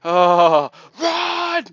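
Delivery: loud, high yell of alarm; mock panic shout. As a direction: shout as if caught red-handed. Caught in Mitzi's bed, mock panic.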